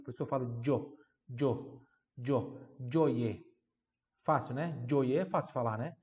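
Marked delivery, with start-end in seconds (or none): 1.4-2.4 gio with a dj sound, short and clipped. Shown as a demonstration. Teacher explaining the G, gio sounds dj. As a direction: repeat gio starting on dj. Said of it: "Esse G tem som de D".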